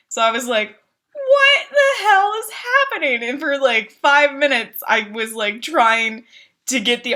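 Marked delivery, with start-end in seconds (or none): 1.1-3.2 high, slowed crying shout; frantic yell. Retold or imitated. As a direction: yell it in disbelief, much higher. Game won't trigger fight.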